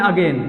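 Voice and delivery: AGAIN said "a-gen" with short e; common mispronunciation. Shown as a demonstration. Teacher showing students the familiar wrong "अगेन", common mispronunciation. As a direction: say "अगेन" with a short, clipped second vowel, "a-gen". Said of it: "ये भी, ये भी word गलत है".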